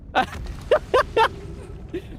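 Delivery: long, high, breathless laughter; high wheezing laugh. Real, not acted. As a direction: crack up helplessly, high and airy. Laughs at the perched sight.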